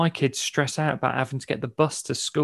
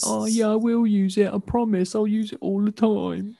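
put-on high pleading teenager voice; whiny teen promise; 8 semitones higher, 3 dB louder than usual. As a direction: beg like a teen wanting a gift. Teen kids' promise retold.

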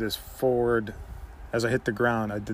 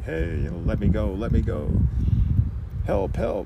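retold screaming; 5 dB louder than usual. Son retelling his mom screaming at night, imitated panicked yell. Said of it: "my mom screaming".

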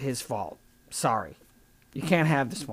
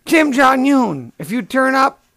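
loud, higher call to the absent leader; 6 semitones higher, 6 dB louder than usual. Pretend. Comic addresses the missing North Korean leader, calling out. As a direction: call him out, stretching the name.

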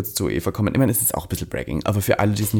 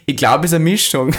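higher, mock shout to a partner; nachgeahmtes Schreien; 7 semitones higher, 4 dB louder than usual. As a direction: imitate a man yelling to his wife. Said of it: "seiner Frau geschrien hat". (nachgeahmtes Rufen, etwas höher)

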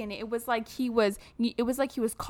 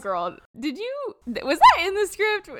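incredulous; 10 semitones higher, 5 dB louder than usual. Retold or imitated. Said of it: "And I was like".

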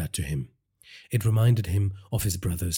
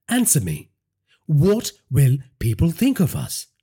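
angry demand; 8 semitones higher, 5 dB louder than usual. Acted. Wife demands an answer.

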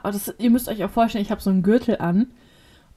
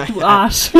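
laughing; 7 dB louder than usual. Real, not acted. [name] laughs at her teasing cohost, laughing. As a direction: burst of laughter, then laughing insult.

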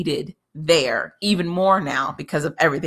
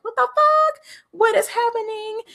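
much higher, squeaky mock disbelief; stunned disbelief. Anna watching the speech turn into war, in high mock shock. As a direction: act out a jaw-dropping shock. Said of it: "she's still sitting there just like".

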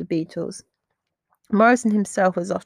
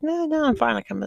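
put-on drunk insisting he's fine; 8 semitones higher than usual.

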